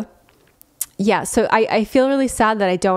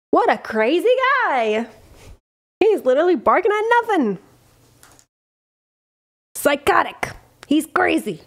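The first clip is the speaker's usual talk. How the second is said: talking to dog; 7 semitones higher than usual. Real, not acted. Talking about the barking dog.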